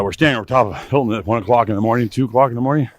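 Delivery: ordinary delivery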